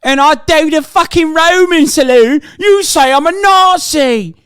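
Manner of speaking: British accent, sneering with fake outrage; mock British lad accent. Streamer mocking a far-right Brit in a British accent. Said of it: "Why are you so good with that accent?".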